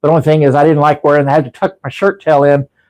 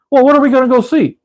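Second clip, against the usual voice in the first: a lightly voiced Italian mobster, higher and quick; Sal's mobster voice; 8 semitones higher, faster than usual. Imitating Sal the mob character answering Tommy, quick and higher. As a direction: toss off the question like a wiseguy. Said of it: "That's what he talks like that".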